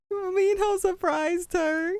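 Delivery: high, slow, tearful squeal; squeaky, choked-up fangirl voice; about to cry. Real, not acted. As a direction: gush on the verge of happy tears. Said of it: "I'm gonna cry".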